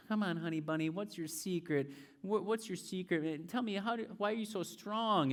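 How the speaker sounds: lightly voiced pleading girlfriend, soft; coaxing Delilah. Delilah coaxing Samson for secret.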